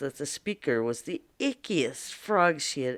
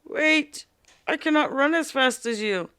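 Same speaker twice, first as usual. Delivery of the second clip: higher calling frog with a light croak; 9 semitones higher, 3 dB louder than usual. Acted. Frog calls after the fleeing princess in a light croak. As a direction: call after her like a little frog. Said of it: "The frog croaked after her".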